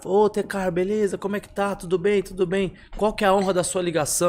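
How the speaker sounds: cheerful pastor. Retold or imitated. Pastor answering the phone greets the caller cheerfully. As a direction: greet him warmly as a beaming pastor.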